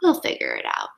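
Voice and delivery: whispered. Acted. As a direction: whisper it reassuringly. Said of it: "They smiled and whispered".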